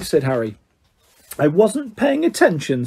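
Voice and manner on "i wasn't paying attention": put-on high, self-pitying ghost girl; dramatic. A narrator's reading. Myrtle answering Harry's question, dramatically. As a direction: play it up tragically. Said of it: "said Myrtle dramatically".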